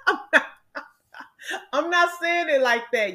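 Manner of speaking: breaking into laughter mid-denial; real giggle while protesting, pitch jumping up; laughing through words. Real, not acted. Laughing at her own joke.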